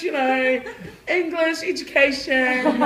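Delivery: high, loud, laughing show-off speech. Retold or imitated. Showing students how to talk to a teacher, loud hammed-up. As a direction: ham it up like an Academy Award act.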